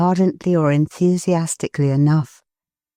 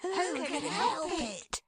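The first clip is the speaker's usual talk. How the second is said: much softer, higher, slow hushed chorus; children's chorus; 12 semitones higher, 16 dB quieter, slower than usual. Acted. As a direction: echo like happy little children. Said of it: "echoed the children in a joyful chorus".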